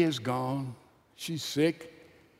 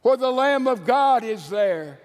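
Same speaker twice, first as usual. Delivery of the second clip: fiery preaching; 6 semitones higher than usual. Acted. Preacher rallying the congregation, full-force preaching. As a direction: lift the cross up with full-voiced fervor.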